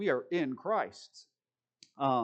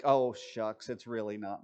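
mock false modesty; 6 semitones lower than usual. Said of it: "false humility".

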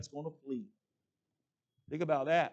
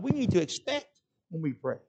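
usual speaking voice

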